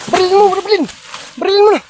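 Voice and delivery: high, loud urging to shoot; urgent shout. Real, not acted. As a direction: yell shoot it now.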